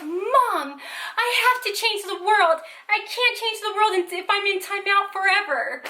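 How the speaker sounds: high, wailing imitation of a crying boy; mock bawling child. Son protests timeout to mom, mock bawling. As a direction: imitate a kid's tearful, dramatic protest. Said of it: "he started the biggest bawling and crying".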